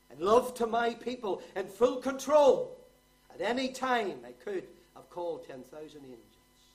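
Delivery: sudden loud, high pulpit cry, easing off at the end; full-force preaching. Real, not acted. Preacher speaks Christ's words of full control, full-force preaching. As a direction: burst out loud, then come down.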